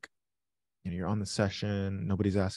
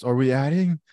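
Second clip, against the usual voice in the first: higher, louder mock-urgent question; all-caps reading; 4 semitones higher, 6 dB louder than usual. Streamer reads an eager chat message, mock urgent shout. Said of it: "put in all caps".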